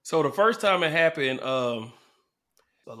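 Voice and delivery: normal talking voice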